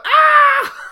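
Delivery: high loud fan scream; excited scream. Retold or imitated. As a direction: scream like a thrilled fan in a truck. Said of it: "I'm screaming".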